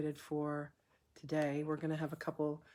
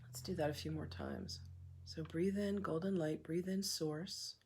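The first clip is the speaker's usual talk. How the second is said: soft, unhurried meditation voice with long pauses; hushed, calm breathwork leader; guided meditation; 7 dB quieter than usual. Acted. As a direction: guide them gently through each breath, leaving room to breathe.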